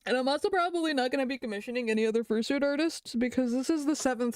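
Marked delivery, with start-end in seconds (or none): none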